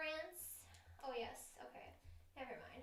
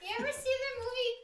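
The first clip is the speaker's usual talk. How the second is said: hammed-up voice; 9 semitones higher, 8 dB louder than usual. Joking movie pitch.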